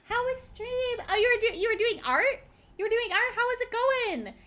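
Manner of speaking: high, bouncy streamer chatter; pep up the guest; cute anime streamer. Acted.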